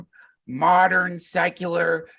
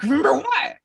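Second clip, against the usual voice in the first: much higher, louder puzzled ask; surprised question; 11 semitones higher, 4 dB louder than usual. Retold or imitated. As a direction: caught off guard, ask quickly. Brother's question surprises him.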